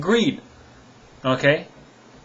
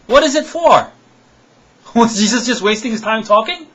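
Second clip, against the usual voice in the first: upset; 4 semitones higher, 3 dB louder than usual. Real, not acted. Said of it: "I apologize if I'm getting upset".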